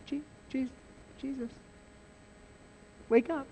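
very soft, hesitant half-whisper; mock-timid, tiny halting voice; timid wake-up call. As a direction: tiptoe round a sleeper, barely daring to speak. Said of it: "Peter goes up to Jesus and goes".

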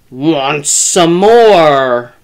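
very slow, drawn-out spooky call; eerie calling. A narrator's reading. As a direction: stretch it out like a lumbering monster.

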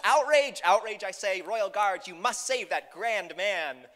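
loud, high royal proclamation; outraged king. Acted. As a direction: command the guards in pompous indignation. King orders guards to rescue.

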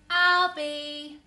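loud call from across the house. Acted. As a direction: call out to the child. Mum calling child, loud.

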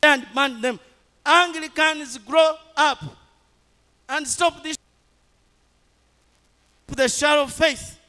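high, loud, blasting rebuke to the congregation; full-force preaching. Acted. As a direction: thunder it at the church like a call to repent.

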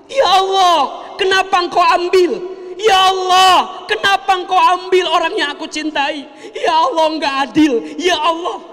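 high, loud wailing complaint to God; a grieving mother wailing at God; mock anguished wailing. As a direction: cry out as if blaming God in grief. Acts out a grieving person blaming God.

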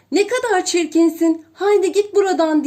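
loud, high, fast jeering; shouting. A narrator's reading. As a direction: shoo the duckling away with scorn. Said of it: "diye bağırır". (çiftlik hayvanları bağırarak kovuyor)

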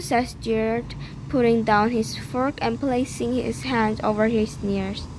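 usual speaking voice

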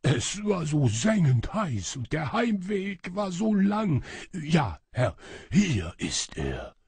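high wail easing down and slowing at the end; lament, then yield wearily; plaintive lament. A narrator's reading. Shadow servant hands master the ring, plaintive. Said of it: "klagte der Schatten".

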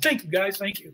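softening, trailing acknowledgement; acted thanks, fading away on the repeat; modest thanks. Wrapped actor thanking the standing crew, modest and softening.

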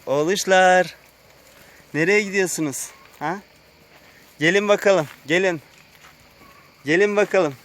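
high, loud pet-talk voice; sing-song call to beloved animals. Real, not acted. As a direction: coax the animals to come, pitched high. Calling his animals over.

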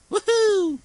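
high, loud woohoo; excited whoop. Real, not acted. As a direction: cheer as you leap. Cheering in the cave.

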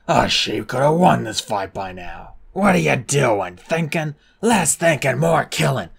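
loud mocking heckling; jeering crowd. A narrator's reading. Crowd taunting Clay for thinking, loud. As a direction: heckle the fighters.

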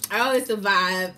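ordinary delivery

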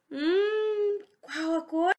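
ordinary talk, nothing marked

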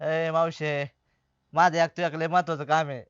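low, soft and sorrowful; subdued, heavy-hearted request; grave, dejected plea. Acted. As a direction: entrust her as if time is short. Dejected man entrusts his daughter.

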